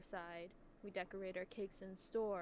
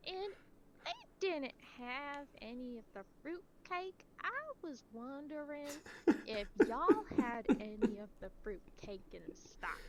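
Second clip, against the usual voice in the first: put-on slow, high Southern old lady caller; 5 semitones higher than usual. Caller asking about fruit cake, slow Southern old lady voice. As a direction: drag each word out like a slow old caller. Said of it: "at this speed".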